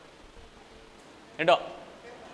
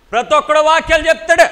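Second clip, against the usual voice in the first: raised, high-pitched protest; imitated loud complaint; 8 semitones higher, 7 dB louder than usual. Preacher mimicking a man who walked out, loud complaint. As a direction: imitate a heckler calling out loudly.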